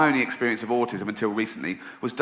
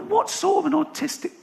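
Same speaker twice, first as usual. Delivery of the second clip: incredulous thought. Retold or imitated. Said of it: "I thought".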